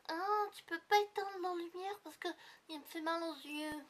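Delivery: put-on high, loud little girl whining; child voice. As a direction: whine it like a small niece. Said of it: "elle fait".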